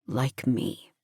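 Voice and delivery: low, soft male voice; soft agreement. A narrator's reading. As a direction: agree gently, dropping low. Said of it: "He agrees softly".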